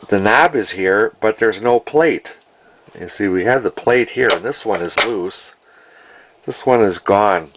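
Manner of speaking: ordinary talk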